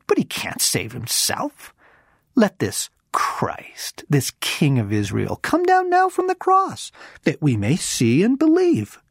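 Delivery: scornful priests, swinging up high; mocking. A narrator's reading. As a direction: taunt him, climbing to a high jeer. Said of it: "mocked him among themselves".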